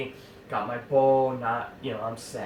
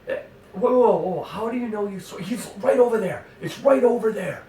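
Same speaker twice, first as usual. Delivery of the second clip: excited calming; 9 semitones higher, 6 dB louder than usual. Retold or imitated. Speaker tries to calm Matt.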